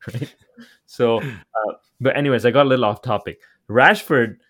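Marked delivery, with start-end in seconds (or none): none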